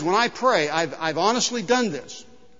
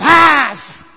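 loud, sudden striking-snake noise; snake hiss imitation; 7 semitones higher, 12 dB louder than usual. Snake springs from the dish at the boy, loud hiss. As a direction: burst out loudly as the snake. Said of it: "there's a hissing snake going".

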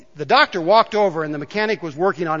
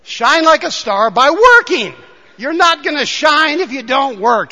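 fired-up preacher; loud exhortation; 7 semitones higher, 7 dB louder than usual. Real, not acted. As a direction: preach it out with full force.

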